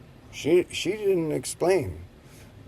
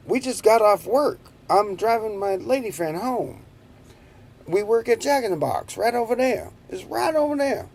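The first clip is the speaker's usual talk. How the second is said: high, nervous explaining to the cop; nervous pleading; 8 semitones higher than usual. Retold or imitated. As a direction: talk fast and high like a scared kid caught by police. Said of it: "I said".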